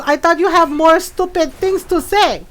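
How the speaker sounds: loud, high challenge in mom's voice; hammed-up scolding mother impression; imitated Filipino mom. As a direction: call them back like a feisty mom. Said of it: "She's like".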